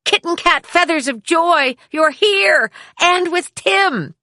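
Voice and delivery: mother greeting her daughter at the door; excited shouting. A narrator's reading. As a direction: burst out the door with delight. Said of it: "ran out shouting".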